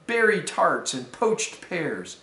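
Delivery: usual speaking voice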